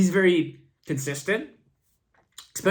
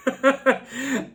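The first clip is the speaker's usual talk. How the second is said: laughing; 6 semitones higher than usual. Real, not acted. Laughs at rough recording.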